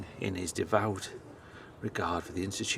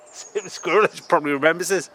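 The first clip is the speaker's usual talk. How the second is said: laughing; 8 semitones higher, 10 dB louder than usual. Real, not acted. Laughs at same squirrel.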